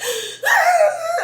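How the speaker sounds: sharp high gasping sob; mock gasp as the tears start; imitated sob gasp. Breaking down while venting, a gasping sob. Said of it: "I just start bawling".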